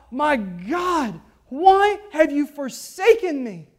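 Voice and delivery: loud pleading cry of anguish. Acted. Preacher voicing Jesus abandoned on the cross, loud anguished cry. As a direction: cry the question out in pain. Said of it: "in anguish, cries out".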